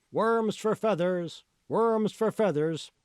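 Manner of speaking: merchant's cry. A narrator's reading. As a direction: sing it out like a peddler hawking wares. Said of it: "the merchant cried out".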